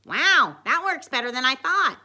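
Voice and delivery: loud, high delighted surprise; amazed. A narrator's reading. Dwight seeing his gadget work, loud amazement. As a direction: exclaim with impressed surprise.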